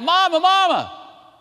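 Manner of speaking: high, loud, drawn-out cry for mother; frightened child's cry. Retold or imitated. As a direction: act out a scared boy calling out. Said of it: "He cried out".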